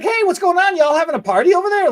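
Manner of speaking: high, loud nosy client barging in cheerfully; chirpy client. Retold or imitated. Nine o'clock client barging in, high and nosy. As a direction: sing it out like a curious client walking in. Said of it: "she's like".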